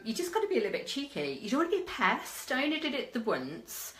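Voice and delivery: ordinary talk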